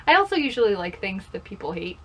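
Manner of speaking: usual speaking voice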